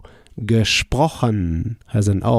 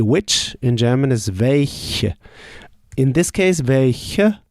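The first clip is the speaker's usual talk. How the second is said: ordinary talk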